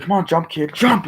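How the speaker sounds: high, rising shout on the last jump; hammed-up high, urgent call; mock urgent urging. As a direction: yell at the kid to leap. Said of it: "It's like".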